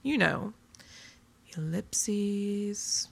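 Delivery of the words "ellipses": drawn out and softer, like trailing dots; trailing ellipses. Shown as a demonstration. Blogger acting out a writing device, trailing ellipses. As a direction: let the word hang and trail off. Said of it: "tricks of the trade that we use to convey tone".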